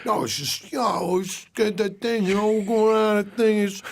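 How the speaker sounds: slow, mumbled mush of half-words; marbles in the mouth. Acted. Imitating how brother talks when asked, garbled marble-mouthed mumble. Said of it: "talks like he's got marbles in his mouth".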